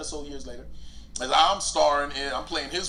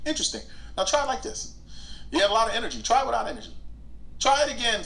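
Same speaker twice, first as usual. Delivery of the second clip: lightly voiced director, higher and brisk; director's voice; 7 semitones higher, faster than usual. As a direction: play the coaching director giving notes. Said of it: "the director will be like".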